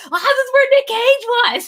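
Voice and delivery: high, awed mock gush; in an Urban Outfitters, imitating starstruck awe. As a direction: gush like a fan at a film location. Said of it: "I was like".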